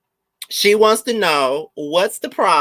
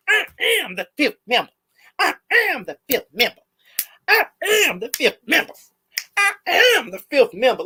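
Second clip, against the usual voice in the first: hammed-up imitation, high and mocking; mocking impression; 11 semitones higher, faster than usual. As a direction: parrot her pompously. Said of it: "she kept on saying".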